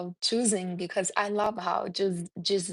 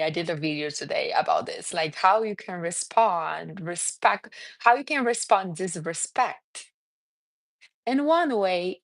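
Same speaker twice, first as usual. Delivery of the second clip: usual speaking voice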